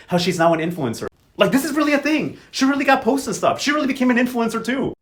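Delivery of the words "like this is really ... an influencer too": much higher, excited disbelief; amazed. Real, not acted. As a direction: gush at how wild it is. Amazed at grandma influencer.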